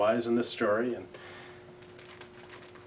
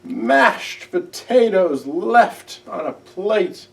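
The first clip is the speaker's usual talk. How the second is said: higher, louder, tearful little owl; sobbing Owl; 6 semitones higher, 8 dB louder, slower than usual. A narrator's reading. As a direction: plead it through tears, rising and loud. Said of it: "he cried".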